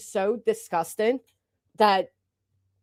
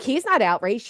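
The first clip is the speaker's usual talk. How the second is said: normal talking voice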